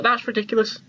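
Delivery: usual speaking voice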